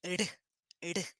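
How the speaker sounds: two short soft R taps; tapped R. Shown as a demonstration. Teacher showing the effort in the neck, tapped R. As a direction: tap R quickly with a little throat effort. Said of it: "Faz uma força no pescoço".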